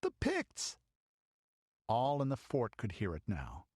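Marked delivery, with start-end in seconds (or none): 0.0-0.8 put-on a very high, terrified girl's cry. Girl recognizes the Pict war cry, terrified. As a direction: cry out the dreaded name.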